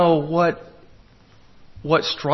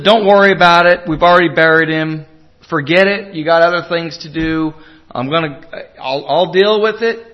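louder, insistent dismissal; loud brush-off; 9 dB louder than usual. Acted. Preacher voices someone refusing the Lord's help, loud brush-off. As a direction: wave the problem away forcefully. Imagined brush-off, instead of inviting the Lord.

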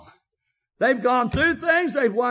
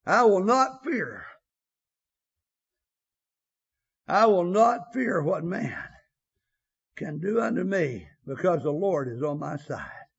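solemn scripture recitation; 7 semitones lower than usual. Acted. Quoting scripture to close.

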